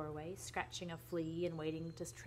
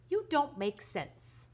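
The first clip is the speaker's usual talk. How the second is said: high, slow, loud bafflement; acted exasperated wife; 8 semitones higher, 6 dB louder, slower than usual. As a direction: stretch it out in exasperation. Baffled wife challenges gloomy husband.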